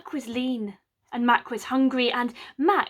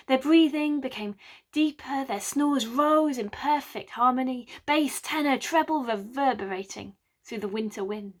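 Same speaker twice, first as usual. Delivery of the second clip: ordinary delivery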